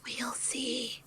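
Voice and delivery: hushed whispered reply; whispering. Acted. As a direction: whisper it back slyly. Whispered repeat of the banter.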